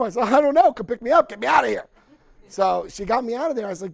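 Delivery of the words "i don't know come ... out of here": loud, high pleading; desperate plea. Retold or imitated. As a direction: beg to be picked up. Calling mother after release, crying.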